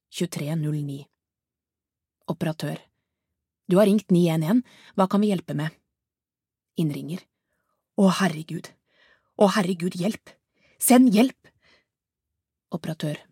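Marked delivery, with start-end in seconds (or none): 3.7-5.7 louder, brisk dispatcher voice; 911 operator. Acted. 8.0-11.5 frantic woman calling emergency services; panicked caller. Acted. Narrator voices panicked caller.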